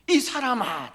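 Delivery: high-pitched exasperated protest; indignant retort. Acted. Peter answering the man who spotted his dialect, indignant.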